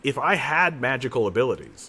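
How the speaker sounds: normal talking voice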